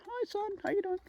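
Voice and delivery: very high squeaky greeting; silly hello to the sun. Pretend. Vlogger playfully greeting the sun in his face, very high-pitched. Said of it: "let me say hello to the sun".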